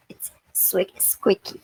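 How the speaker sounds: whispered then squeaked; squeaky. Shown as a demonstration. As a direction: breathe the word then squeak it. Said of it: "Gabby says squeaky".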